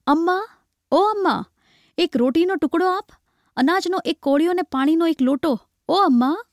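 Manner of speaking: high-pitched begging cry from outside; beggar's call. A narrator's reading. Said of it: "એક બૂમ સંભળાઈ". (ભિખારીની ઊંચા સૂરની બૂમ)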